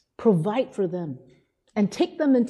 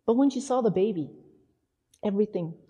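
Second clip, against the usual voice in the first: ordinary talk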